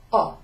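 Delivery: O as a pure open "o", no h; silent h. Shown as a demonstration. Teacher demonstrating ho for learners, O without any h. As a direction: say O with the h dropped. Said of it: "we don't pronounce the H".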